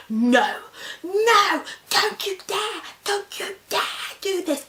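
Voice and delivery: high, panicked-sounding refusal; hammed-up shrieked warning; retold yelling. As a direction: yell it way up high at the doctor. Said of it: "I'm yelling at her".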